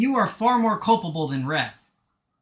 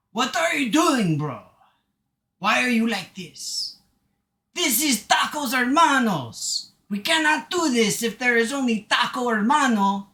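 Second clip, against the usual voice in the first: put-on higher, emphatic Mexican accent; hammed-up Mexican accent, indignant and pitched up; Luis Guzman; 5 semitones higher, 4 dB louder than usual. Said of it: "I need to channel Luis Guzman".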